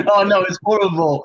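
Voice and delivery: high-pitched, through laughter; laughing. Real, not acted. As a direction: say it cracking up at the coach's accent. Laughing verdict on coach's accent.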